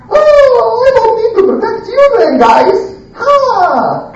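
very high, quick, amazed rush; amazed relief. Acted. Prophet to his angel guests, high amazed relief. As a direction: squeal with sudden realization.

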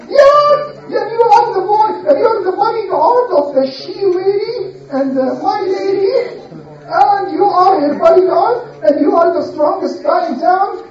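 high, sing-song mocking voice; mocking crowd. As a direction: tease him in a high taunting tone. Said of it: "they used to mock him".

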